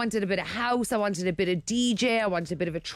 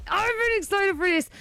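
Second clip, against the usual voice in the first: high and bright, stress on been; excited; 11 semitones higher than usual. Real, not acted. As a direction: lift it, really eager.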